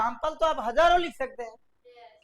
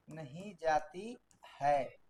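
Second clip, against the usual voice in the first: very slow, softer, lower dictation; dictating the sentence again, slower and quieter; slower softer repeat; 6 semitones lower, 6 dB quieter, much slower than usual. Shown as a demonstration. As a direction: repeat it slowly so they can write. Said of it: "practice के लिए sentence लेते हैं".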